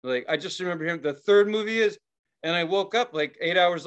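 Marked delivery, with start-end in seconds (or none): 1.0-2.0 higher, louder mock announcement; imitated movie announcer. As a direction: declare it like the radio host introducing a film. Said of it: "And then they said".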